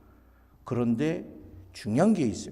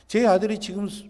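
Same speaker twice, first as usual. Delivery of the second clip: normal talking voice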